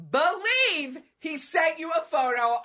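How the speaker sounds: slow, high exasperated disbelief; drawn-out disbelief. Real, not acted. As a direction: stretch it out in disbelief. Mock outrage, drawn out.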